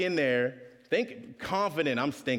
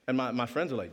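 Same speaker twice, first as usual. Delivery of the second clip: normal talking voice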